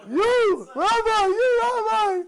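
high, loud, straining call; excited calling out. Retold or imitated. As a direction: yell it as if waving someone down from afar. Stranger calling out to rabbi.

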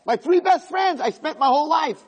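very high, tight voice; soul in panic listing what is left, very high; high strained voice. Acted. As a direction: squeeze the words up high in panic. Possessions voice, then soul panic.

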